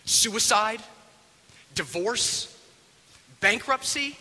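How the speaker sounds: high-pitched, indignant list of grievances; exasperated, rising bursts with pauses between; angry outburst. Acted. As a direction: fling each word as an accusation. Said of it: "and he blows up".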